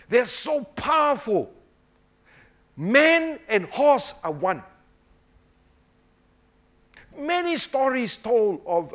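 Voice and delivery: high, slow, loud declamation; preaching. Real, not acted. Preacher marvelling at horse and rider, loud slow declamation. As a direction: proclaim it slowly, pitched high and loud.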